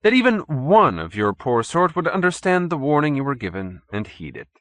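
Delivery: polite scorn; urbane. A narrator's reading. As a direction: urbane chairman scolding his captive. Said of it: "Stolz continued urbanely".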